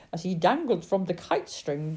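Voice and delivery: plain reading voice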